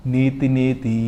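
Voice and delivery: low, level chant; scriptural intonation. Acted. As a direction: intone it evenly and let it linger. Intones the Upanishad quote. (ఉపనిషత్ వాక్య పఠనం)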